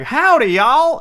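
loud, high Southern twang; hammed-up Southern holler; thick Southern accent. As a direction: exaggerate a heavy Southern accent. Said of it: "incredibly heavy, thick accents like".

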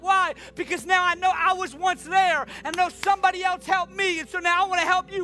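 high, loud, fervent sermon voice; full-force preaching. Acted. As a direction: preach it out to the whole room.

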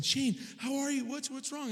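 put-on high, anxious questioning of a child; worried parent. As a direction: act out an alarmed dad. Worried father questions screaming son.

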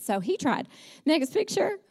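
ordinary delivery

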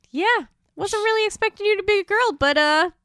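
high, playful devil voice; lightly voiced smug devil; mock Satan. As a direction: ham up a chummy devil. Satan voiced in retold scene.

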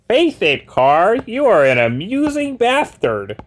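high, slow goofy loser voice; a high comic hero voice; Carr voice. Acted. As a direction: admire yourself in the mirror, goofily. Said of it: "not in the Carr voice".

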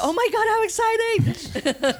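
squealing with delight; high excited squeal. Retold or imitated. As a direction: gush way up high. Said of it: "So then I said".

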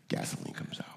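breathy whispered repeat. Acted. Preacher repeats the answer to the crowd: whispered. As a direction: whisper it back, barely voiced.

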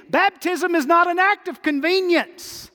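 high, loud and ringing, hammering "not"; preaching at full force. Real, not acted. Preacher pressing the unbaptized in the pews, high and loud preaching. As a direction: shout the conviction up to the back row. Preacher driving home his point.

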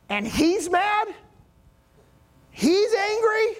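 high, drawn-out disbelief; astonished, rising pitch on each question; incredulous questioning. Acted. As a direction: stretch it slowly into a soaring, baffled question. Preacher voices disbelief at Jesus' anger.

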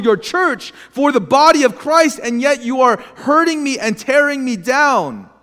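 pleading with God, voice pushed high; impassioned, bewildered cry of why; retold anguished questioning. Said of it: "I remember thinking, like".

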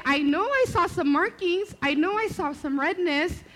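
high, loud self-defence; insistent protest. Retold or imitated. Mother insists to the doctor about the bite, loud protest.

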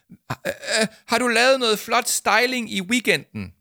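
high, loud, flustered question; nervous shout. Acted. Flustered Arthur asks Jama loudly, nervous shout. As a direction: blurt it out too loud under pressure. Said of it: "han ville råbe højt".

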